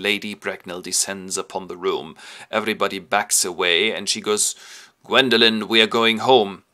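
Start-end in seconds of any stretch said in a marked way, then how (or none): 5.0-6.6 loud, commanding grand dame; lightly voiced imperious matron; stern dowager. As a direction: lay down the law like an old aristocrat. Lady Bracknell commands her daughter.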